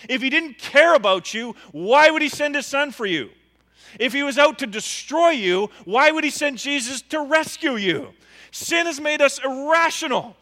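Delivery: loud and high, pressing each question; preaching at full force. Acted. Preacher pleading with friends about God's love, loud. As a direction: drive the point home to the congregation.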